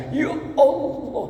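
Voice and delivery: slow, high drawn-out sigh to God; long plea. Retold or imitated. Preacher voices a praying man, slow and high. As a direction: stretch it out like a weary plea.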